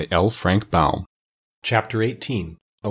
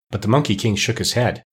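usual speaking voice

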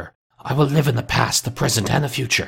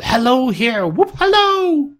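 very high, drawn-out calling; joyous whoop; 4 dB louder, slower than usual. A narrator's reading. Scrooge greeting all the world, joyous whoop. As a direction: holler in giddy falsetto.

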